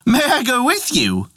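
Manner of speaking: high rooster voice. Acted. Rooster asks to come.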